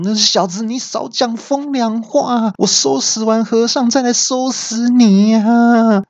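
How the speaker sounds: high, threatening villain shout; enraged thug shouting at the kid; angry yell. A narrator's reading. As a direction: bark a threat at the heckler. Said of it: "然后三怪就大叫说".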